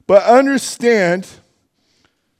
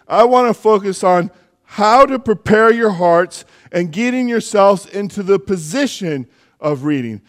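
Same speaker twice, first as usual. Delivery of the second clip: ordinary talk, nothing marked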